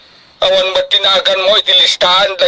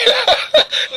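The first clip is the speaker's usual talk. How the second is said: a squealing delighted laugh, much higher; proud high laugh. Acted. Father tickled by praise of his smarts, giddy high laugh.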